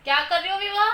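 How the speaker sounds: loud, high call across the room; mother calling her daughter loudly. Real, not acted. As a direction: call out to the little one.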